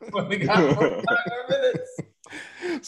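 loud, high laughing repeat. Real, not acted. Laughing at the short airtime.

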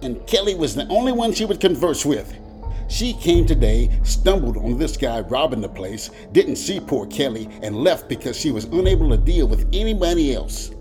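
high, insistent witness account; urgent testimony. A narrator's reading. Little man explaining the crime to cop, urgently.

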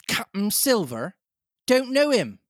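high, incredulous call back; mock-puzzled cry. A narrator's reading. Captain scorning Silver's new title, crying out. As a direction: cry out as if you've never heard the name. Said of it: "cried the captain".

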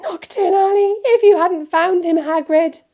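high, loud, overwrought gasping fuss; frantic, grateful wail; horrified. A narrator's reading. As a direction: exclaim in motherly horror. Horrified mother thanks rescuer.

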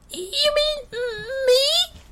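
tiny, extremely high mouse squeak; timid squeaky mouse; extra squeaky voice. A narrator's reading. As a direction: squeak it hesitantly, far up in pitch. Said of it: "with an even squeakier voice than usual".

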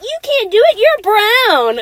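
high, loud mocking outburst; mock judging shout. Speaker retelling being judged for her Spanish, high mock shout. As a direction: yell it like a scandalised critic.

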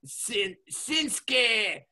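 hammed-up Japanese accent, high and loud; high, drawn-out mock Japanese name call; Shinsuke Nakamura. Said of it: "I don't wanna sound racist".

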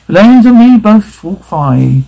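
slow, high, drawn-out spectral voice; eerie curse. Acted. Ghost speaks the wager's curse, slow and unearthly. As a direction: intone it like a ghost laying a curse.

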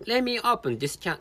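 ordinary delivery

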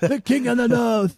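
high-pitched Northern English proclamation; mock battle cry. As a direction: playfully hail a king in a Northern accent.

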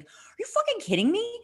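high-pitched disbelieving outburst; incredulous outrage. Retold or imitated. Sister retelling her reaction to her brother, outraged. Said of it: "I was like".

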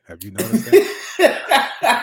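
laughing through the question; high, slow laughing question. Real, not acted. Laughing about choking.

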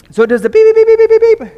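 high loud mock beeping; shrill electronic beeps; car beep imitation. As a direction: squeal the warning beeps.

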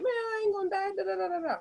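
high, cocky brush-off; spunky defiance. Retold or imitated. Young guest dismissing the doctor's warning, cocky and high. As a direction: wave off death like a stubborn youngster. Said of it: "I'm spunky".

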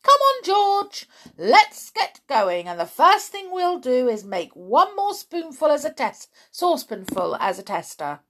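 loud, high, eager urging; rally the boy to get started; bustling, commanding enthusiasm; excited dad. A narrator's reading.

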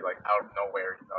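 ordinary talk

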